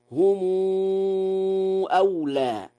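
هم stretched to humuu before أولى; lengthened plural mim. Shown as a demonstration. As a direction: hold a long "uu" after the mim of هم. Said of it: "وله صلة ميم الجمع".

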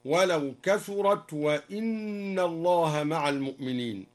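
"وإن" said with a kasra on the hamza, wa-inna. Shown as a demonstration. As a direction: open the particle as "وإن" wa-inna, not wa-anna. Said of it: "هكذا".

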